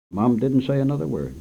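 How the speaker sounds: ordinary delivery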